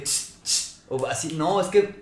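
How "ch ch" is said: soft voiceless "ch-ch"; two short hisses; imitated waiter-summoning hiss. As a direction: imitate a rude customer hissing for service. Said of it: "le hacen a los meseros de que".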